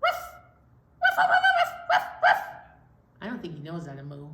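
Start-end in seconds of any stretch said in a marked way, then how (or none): 0.0-2.4 very high, loud yapping woofs; dog barking. Acted. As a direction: bark in quick bursts like an excited little dog. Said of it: "Because dogs say".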